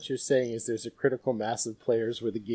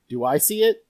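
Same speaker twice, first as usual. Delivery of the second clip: louder, rising question; mock hopeful asking; 6 semitones higher, 10 dB louder than usual. Player tries an ability check at a large table, mock hopeful asking. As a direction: ask it like a player hoping for a lucky roll.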